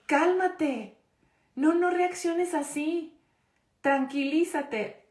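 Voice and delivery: high, slow, preachy mock parent; mock scolding. Teacher mimicking someone telling an angry person to calm down, high and slow. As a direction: lecture them to calm down, hammed-up. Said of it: "y te dicen".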